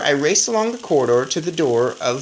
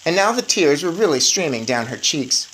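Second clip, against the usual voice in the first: ordinary delivery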